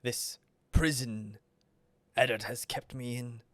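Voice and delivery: soft, measured menace with long pauses; quiet demonic villain. Acted. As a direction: declare your purpose quietly and deliberately. GM voices Vrag, the demonic villain.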